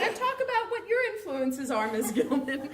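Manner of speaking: high, laughing retold quote; laughing through it. Real, not acted. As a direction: laugh while quoting the host. Retelling being handed the microphone.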